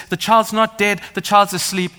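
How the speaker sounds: bold declaration. Retold or imitated. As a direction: proclaim it over the mourners. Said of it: "the moment Jesus says".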